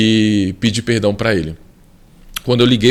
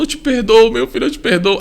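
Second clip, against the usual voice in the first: higher, lightly voiced weeping father; tearful father; 10 semitones higher than usual. Father forgives his son over the phone, mildly tearful. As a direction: echo the father forgiving through tears, understated. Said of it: "Aí foi um chororô".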